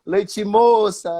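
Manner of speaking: slow sing-out teasing taunt; drawn-out heckle. Retold or imitated. Drunk fan taunts the goalkeeper after a save, slowly. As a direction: imitate the heckler, dragging the name out. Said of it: "ele olhou pra mim e disse assim".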